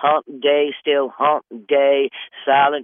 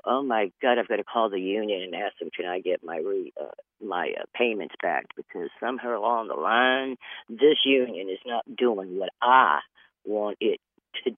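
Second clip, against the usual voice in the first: lower, softer, deflated realization; mock dismay; 5 semitones lower, 5 dB quieter than usual. Waking up months later regretting the union, mock deflated dismay. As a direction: sink into regret, quietly.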